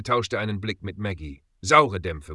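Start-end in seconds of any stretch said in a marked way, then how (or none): none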